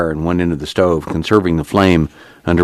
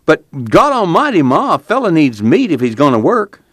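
high whiny complaint; complaining; 11 semitones higher, 3 dB louder than usual. A narrator's reading. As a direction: whine it to his mother. Al keeps complaining.

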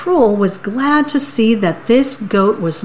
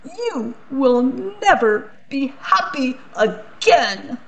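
high, theatrical curse of a wounded archduke; Archduke's curse; 6 semitones higher, 3 dB quieter than usual. Acted. Archduke cursing the little girl in a high voice. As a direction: cast the family curse down on her.

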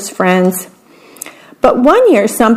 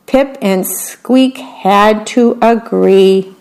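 usual speaking voice

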